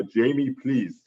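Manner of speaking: prank-call soundboard plea; Arnold Schwarzenegger impression. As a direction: imitate an action hero begging. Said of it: "he'd go".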